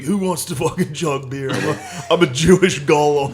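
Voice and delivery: mock stage shout. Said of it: "and I was like".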